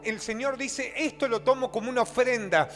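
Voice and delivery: loud, driving preacher's exhortation; predicación enérgica. Real, not acted. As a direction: build point on point at full preaching force. Predica con toda su fuerza. (prédica fuerte y apasionada)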